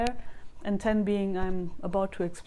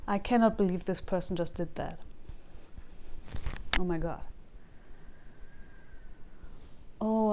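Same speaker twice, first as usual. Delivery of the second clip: tapping script; 5 dB quieter than usual. Acted. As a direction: voice the anger calmly, one phrase at a time. Voices anger while tapping points.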